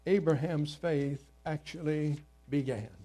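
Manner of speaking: usual speaking voice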